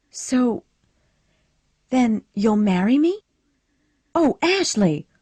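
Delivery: high, loud joyful cry; cried out. A narrator's reading. Scarlett thinking Ashley will marry her, high excited cry. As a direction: burst out with delight. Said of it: "Scarlett cried".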